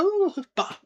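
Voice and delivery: high squeal of a bat fright; mock panic. Ron seeing the bats, mock squeal. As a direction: shriek at the bats in jest. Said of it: "And he's like".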